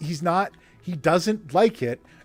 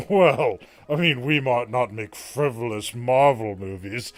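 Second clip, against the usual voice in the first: low, slow, self-important put-on voice; pompous studio executive; 6 semitones lower, slower than usual. Host mocks Warner Brothers people looking down on Marvel, pompous drawl. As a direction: draw it out with haughty disdain. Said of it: "fucking people from Warner Brothers".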